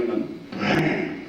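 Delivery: usual speaking voice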